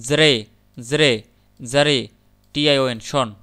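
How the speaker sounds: জরে spoken slowly as its own syllable. Shown as a demonstration. Teacher sounds out each syllable of exaggeration, slowly. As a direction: drag out জরে, slow and separate. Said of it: "এখানে মোট চারটা শব্দ অক্ষর".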